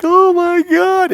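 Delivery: very high, loud, drawn-out mock wail; retold horrified wail. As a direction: imitate a mother wailing in horror. Bereaved mom's horror, acted out.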